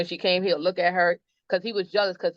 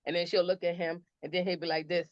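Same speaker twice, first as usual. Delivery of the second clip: normal talking voice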